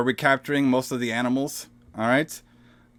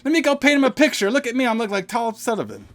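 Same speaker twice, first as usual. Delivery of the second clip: high, fast, louder mock voice; much higher, fast goofy impression; silly naive voice; 11 semitones higher, 5 dB louder than usual. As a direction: ham up a carefree fool. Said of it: "Robin's like".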